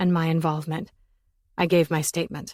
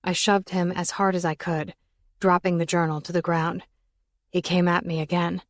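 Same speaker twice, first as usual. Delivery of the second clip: ordinary delivery, nothing marked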